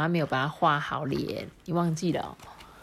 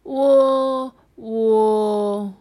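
drawn-out halting "我"; slow stammer; 4 semitones higher, 5 dB louder than usual. Acted. The calf trying to speak, slowly stammering. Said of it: "某某慢吞吞地说".

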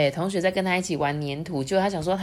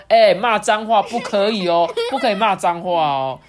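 louder mild warning; a hint of a scolding king; 3 semitones higher, 6 dB louder than usual. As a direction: forbid the swearing. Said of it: "国王就说".

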